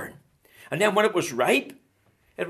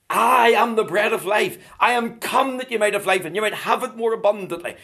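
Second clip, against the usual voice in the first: raised, ringing pulpit voice; impassioned preaching; 4 semitones higher, 4 dB louder than usual. Real, not acted. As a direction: drive each phrase home to the congregation. Preaches at full force.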